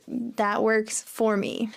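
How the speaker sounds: THAT WORKS FOR ME drawn out slowly; slow model phrase. Shown as a demonstration. Teacher modelling "That works for me" for learners, slowly. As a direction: say "That works for me" at half speed, word by word.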